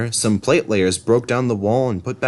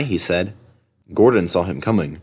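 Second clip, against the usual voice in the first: ordinary delivery, nothing marked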